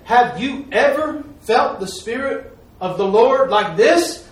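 slow, high, loud question climbing at the end; amazed deacon. Retold or imitated. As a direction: imitate an awestruck man asking earnestly.

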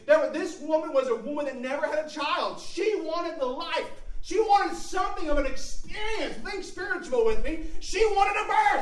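high, loud, driving sermon delivery; impassioned pulpit shout; full-force preaching. Real, not acted. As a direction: preach it out at the top of your range.

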